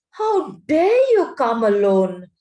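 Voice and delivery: slow, loud, menacing witch; a witch's slow, loud fury; terrible voice. A narrator's reading. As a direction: hurl it slowly at the boy, full of menace. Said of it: "said the witch in a terrible voice".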